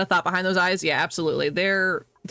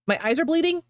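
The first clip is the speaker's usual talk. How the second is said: higher pitch, rising into a question; startled question; 9 semitones higher than usual. Real, not acted. Streamer catches their own slip about bleeding eyes, startled question. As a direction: echo your own words in disbelief.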